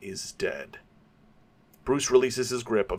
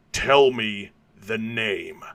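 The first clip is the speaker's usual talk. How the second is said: slow, weighted command, a bit louder; slow stern demand; 4 dB louder, much slower than usual. Acted. Batman demanding a name from Carmine, slow and stern. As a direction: lean on every word to force it out.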